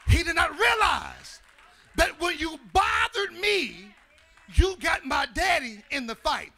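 loud, high whooping sermon cadence; shouted gospel climax with music; full-force preaching. Acted. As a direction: preach it to the rafters, building fervor.

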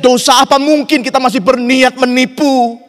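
loud, high, driving sermon delivery; impassioned preaching. Real, not acted. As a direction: preach it at full force to the whole church. Preacher at full force.

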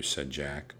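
usual speaking voice

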